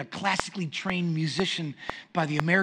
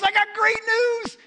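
high, loud, stretched-out announcement; mock excitement; 7 dB louder than usual. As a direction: imitate a thrilled wife breaking good news. Wife announces good news.

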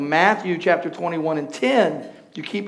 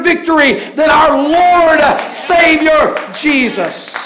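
loud, high, driving sermon voice; thundering pulpit delivery, swelling to shouts; full-force preaching; 9 semitones higher, 10 dB louder, slower than usual. Acted. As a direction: preach it big to the whole church.

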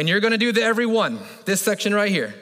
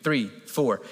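even counted beat, lower; steady cadence; 6 semitones lower, much slower than usual. Shown as a demonstration. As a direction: count it out like a metronome. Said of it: "I'm gonna count a cadence".

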